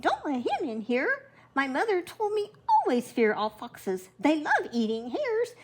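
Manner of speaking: high, panicked hare voice; grandma reading the hare warning Joseph about foxes; shrieking hare. A narrator's reading. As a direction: squeal the warning like a scared little hare. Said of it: "The hare shrieked".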